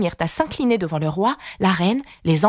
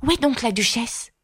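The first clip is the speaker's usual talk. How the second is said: put-on girlish question; 6 semitones higher than usual. A narrator's reading. Alice asks the rabbit about the duchess: high girlish question. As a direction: ask it in a high girl's voice.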